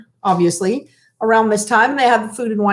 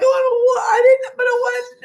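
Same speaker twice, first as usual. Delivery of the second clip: imitating a much higher, crying wail of denial; crying protest; 11 semitones higher, slower than usual. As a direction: mimic a teary daughter pleading she didn't do it.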